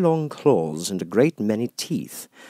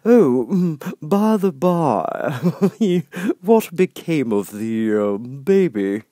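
grinning Cheshire Cat; 7 semitones higher, 6 dB louder, much slower than usual. Acted. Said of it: "said the cat".

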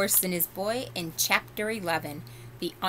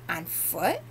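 doubting gentleman. Acted. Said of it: "raising his eyebrows a little".

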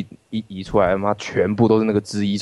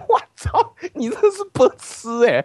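mock inner exasperation. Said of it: "然后我想说".

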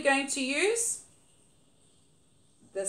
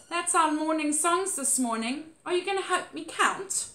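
usual speaking voice

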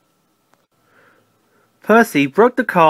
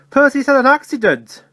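urgent news; 8 semitones higher, 3 dB louder than usual. A narrator's reading. Toby hurries in with bad news.

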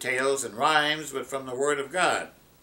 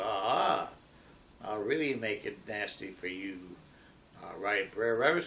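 lower, softer nasty threat; spiteful fox; 4 semitones lower, 4 dB quieter than usual. Acted. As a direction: menace him quietly.

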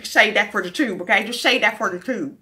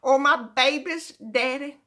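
normal talking voice

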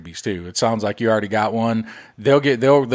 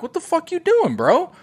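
put-on high, startled outburst at the kid; squeaky mock-alarmed what the fuck; exasperated yell. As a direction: bust in on him mid-crime. Catching son buying subscription.

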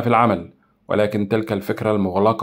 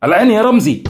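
sudden call; 11 semitones higher, 8 dB louder than usual. Acted. Signal to start the escape.

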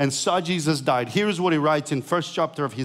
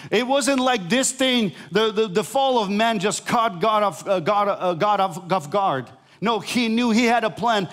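preaching at full force; 7 semitones higher than usual. Acted. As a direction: preach it out, building to a climax.

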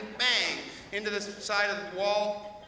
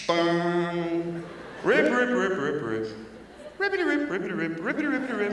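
cat shredding drapes; 3 semitones lower than usual. Acted. Cat shredding drapes, sound effects.

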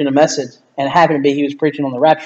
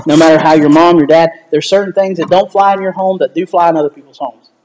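usual speaking voice

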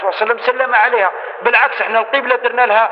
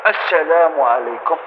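correct gentle salam; 5 semitones lower, slower than usual. Shown as a demonstration. Teacher showing the seated salam, slow and gentle. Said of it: "وانت جالس هكذا".

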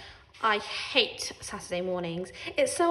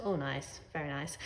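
dry sarcasm; 7 semitones lower, 8 dB quieter than usual. A narrator's reading. Sarcastic aside.

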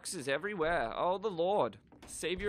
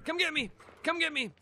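high, sing-out chase taunt; gleeful taunting; 9 semitones higher, 6 dB louder than usual. Pretend. Player daring pursuers to chase him, high playful taunting. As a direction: taunt them in quick, high repeated bursts.

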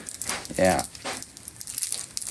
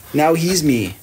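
normal talking voice

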